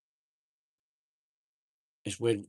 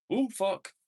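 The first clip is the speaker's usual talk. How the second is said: high startled curse; high surprised swear; 12 semitones higher than usual. Real, not acted. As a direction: jump at something sudden. Startled exclamation.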